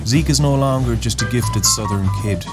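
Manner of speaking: ordinary delivery, nothing marked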